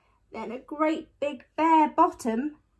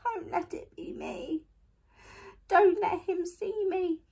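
plead, growing louder and more desperate; whimpering plea; 8 semitones higher, 4 dB louder than usual. A narrator's reading. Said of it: "whimpered Eddie".

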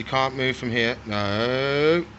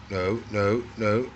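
ordinary talk, nothing marked

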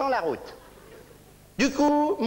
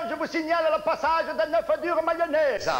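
usual speaking voice

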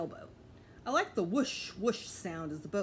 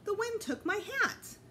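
high, slow distressed cry; dismayed at the loss; upset; 14 semitones higher than usual. A narrator's reading. Woman watching her hat blow off, distressed.

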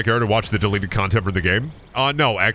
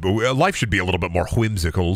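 ordinary talk

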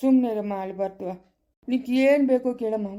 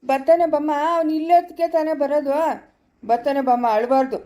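put-on raised voice over a child's crying; loud consoling; 4 semitones higher, 5 dB louder than usual. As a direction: coax a bawling child, loudly. Calming a loudly crying child. (ಜೋರಾಗಿ ಸಮಾಧಾನ ಮಾಡುವುದು)

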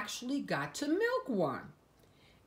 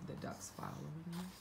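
hushed aside; 14 dB quieter, faster than usual. Real, not acted. Aside while reading.

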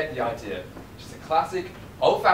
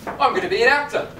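excited; 8 semitones higher, 4 dB louder than usual. Real, not acted. Excited realization.

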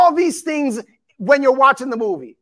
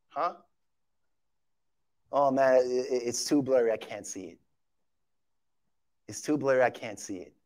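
low, very soft feigned puzzlement; mock cluelessness; 12 semitones lower, 12 dB quieter than usual. Preacher mimics people who can't tell it's evil, hushed mock confusion. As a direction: play someone vaguely squinting, under your breath.

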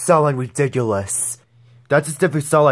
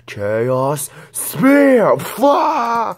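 shouted attack name; battle cry; 9 semitones higher, much slower than usual. Acted. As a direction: yell it as you strike. Attack call in game.

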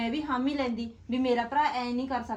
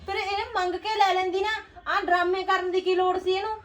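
high, loud indignant yelling; stammering fury, shrill and loud; outraged shout; 7 semitones higher, 6 dB louder than usual. Acted. Wife to husband, furious he gave away her necklace, shouting. Wife complains about sister-in-law.

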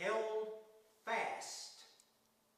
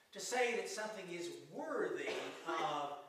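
ordinary talk

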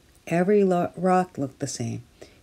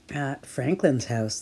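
plain reading voice

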